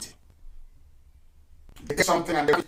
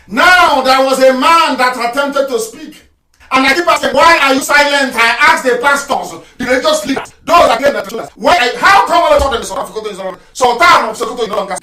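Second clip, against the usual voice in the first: loud, high, driving challenge; full-force preaching; 10 semitones higher, 11 dB louder than usual. Real, not acted. Broadcaster challenging the silent pastors, loud fiery preaching. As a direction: demand answers at the top of your voice.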